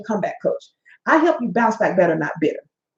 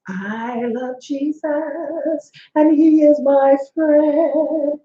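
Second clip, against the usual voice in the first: high, very slow, sugary mock churchgoer; mock churchy piety; 4 semitones higher, much slower than usual. Mocking hypocrites who act holy at church, slow high pious voice. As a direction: drawl it out like a fake-pious saint at church. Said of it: "show up at work or church like".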